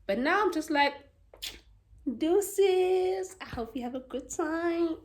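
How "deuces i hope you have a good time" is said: breezy send-off. Acted.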